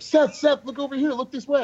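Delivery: high, loud mock calls of photographers; shouting. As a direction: call out to the star from the crowd. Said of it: "thirty people shouting my name".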